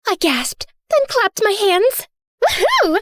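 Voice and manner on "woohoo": high, delighted whoop; gleeful cheer. Acted. Prisoner celebrates the guard being knocked out, gleeful whoop. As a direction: cheer it like a little victory.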